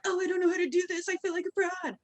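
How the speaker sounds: high-pitched imitation of a worrier; mock self-doubt. Coach imitating people who feel like frauds, high mock worry.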